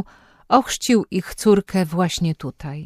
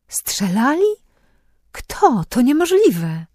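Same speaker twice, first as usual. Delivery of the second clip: shocked disbelief; 7 semitones higher, 4 dB louder, slower than usual. A narrator's reading. Shocked at the papal shooting.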